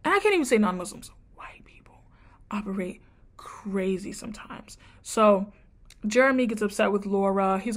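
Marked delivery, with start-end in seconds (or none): 1.4-4.8 whisper. Real, not acted. Cheeky aside she shouldn't say.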